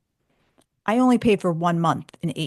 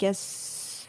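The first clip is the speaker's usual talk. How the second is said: timid whisper; 10 dB quieter than usual. Retold or imitated. Timid answer to instructor.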